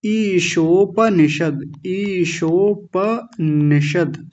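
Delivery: ईशोपनिषद drawn out slowly, full प; teacher modelling the compound name slowly; very slow enunciation. Shown as a demonstration. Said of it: "अब इनके सबके साथ जोड़िए".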